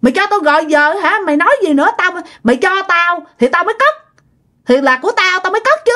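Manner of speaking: high-pitched, defiant retort, acted out; arguing back. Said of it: "bà Sáu bà mới cự lại".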